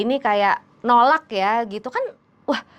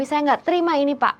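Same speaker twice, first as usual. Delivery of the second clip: high, angry protest; angry refusal; 6 semitones higher, faster than usual. Retold or imitated. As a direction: refuse him in anger. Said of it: "sambil marah sama Romi". (menolak dengan marah)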